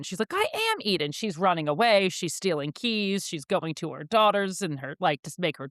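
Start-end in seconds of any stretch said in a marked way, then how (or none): 0.3-1.1 high-pitched insistence on "am"; mock indignant stress on "am"; retold insistence. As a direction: protest in a high voice, leaning on "am". Retold protest to the cop.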